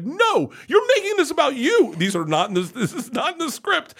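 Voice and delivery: very high, loud, upset wail; imitated crying protest. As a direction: mimic a tearful, outraged daughter. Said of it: "she started crying and she's like".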